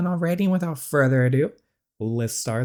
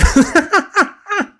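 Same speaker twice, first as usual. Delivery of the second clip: high scornful laugh; mocking laugh; 7 dB louder than usual. Player laughing scornfully at Sawyer's excuse. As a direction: laugh at him.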